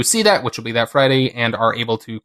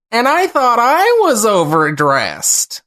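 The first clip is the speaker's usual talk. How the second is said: much higher, louder, slow put-on voice; improv penguin; 10 semitones higher, 4 dB louder, slower than usual. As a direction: stretch it out like a fussy penguin at dinner. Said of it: "You said that in the very similar tone of voice".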